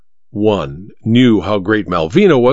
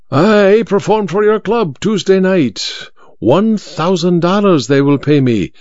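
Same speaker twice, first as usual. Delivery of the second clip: lightly voiced magician; 6 semitones higher than usual. A narrator's reading. Malvino boasts of fee.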